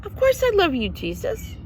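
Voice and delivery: high, quick puzzled Peter voice. Retold or imitated. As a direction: sound baffled at being asked again, high. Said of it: "Peter's like".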